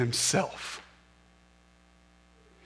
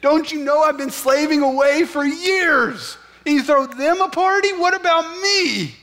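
higher, louder whiny outrage; resentful sibling, pitched up and indignant; indignant older brother; 10 semitones higher, 4 dB louder than usual. Pretend. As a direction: play the jealous brother complaining to his father. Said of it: "because I want recognition for all that I did".

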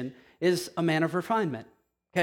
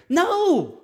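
much higher, loud flat no; emphatic no; 11 semitones higher, 6 dB louder than usual. Real, not acted. Preacher rejects the timid parent, loud emphatic no. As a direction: cut it off hard.

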